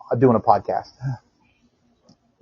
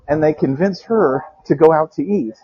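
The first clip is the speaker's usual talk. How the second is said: normal talking voice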